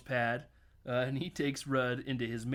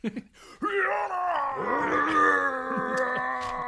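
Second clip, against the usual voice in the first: high, loud sustained yell; long Hulk roar; 12 semitones higher, 6 dB louder than usual. Retold or imitated. Teasing the Hulk actor, long loud mock roar. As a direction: imitate a drawn-out monster bellow.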